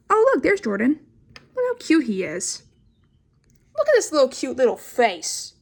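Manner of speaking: high, cutesy cooing over a drawing; gushing coo. Real, not acted. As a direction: gush like over a puppy.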